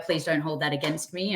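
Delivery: ordinary talk, nothing marked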